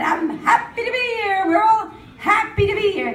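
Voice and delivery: high, bright mock-cheerful chirp; mock happy athlete. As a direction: chirp it like a fake-happy Olympian. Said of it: "you're going".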